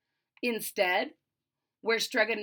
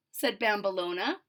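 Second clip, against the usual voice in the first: usual speaking voice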